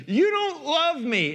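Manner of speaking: high-pitched, drawn-out complaint; mock childish accusation to the Father; accusing child. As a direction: whine it upward like a wronged kid. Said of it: "we look to our Father and say".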